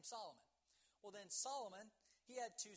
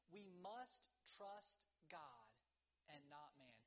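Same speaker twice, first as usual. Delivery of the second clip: solemn, spaced-out preaching; slow, emphatic declaration; 3 semitones lower, much slower than usual. Real, not acted. Preacher drives home key point.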